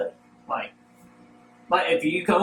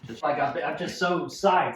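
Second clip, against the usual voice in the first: ordinary delivery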